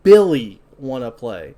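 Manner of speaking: talking toy doll greeting, Billy punched loud; lightly voiced kids' doll; good guy doll voice. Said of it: "in good guy doll voice".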